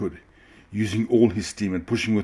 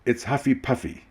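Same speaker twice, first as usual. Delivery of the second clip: very important; 5 semitones higher than usual. A narrator's reading. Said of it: "sounding very important".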